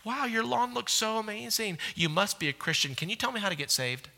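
high, soft, airy gush of amazement; mock-wide-eyed admirer of the lawn; a passing neighbor marvelling at the mowed lawn. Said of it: "your neighbor goes by and goes".

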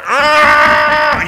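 put-on loud, high frustrated "Argh!" like a stomping child; angry kid's roar. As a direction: roar it out like a little kid throwing a fit. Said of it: "you have this angry prophet".

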